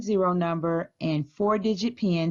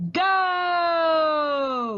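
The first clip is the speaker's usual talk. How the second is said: high shouted go; excited shout; 8 semitones higher than usual. Real, not acted. Librarian starts the storytime challenge race, high excited shout.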